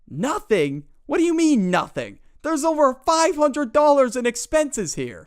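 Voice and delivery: high, loud scolding mother; angry mom. Retold or imitated.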